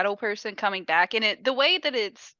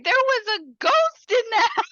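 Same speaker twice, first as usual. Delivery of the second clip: put-on little kid; 11 semitones higher, 3 dB louder than usual.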